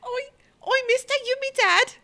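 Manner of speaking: very high, pleading street urchin; little scamp voice. Pretend. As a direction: squeak it out like a needy waif. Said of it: "You might wanna use that voice".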